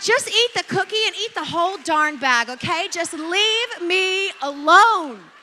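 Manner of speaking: loud, high parental outburst, slowing at the end; exasperated yelling. Retold or imitated. Parent tired of kids asking for cookies, exasperated yell. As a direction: give in at a yell, drawing out the last words.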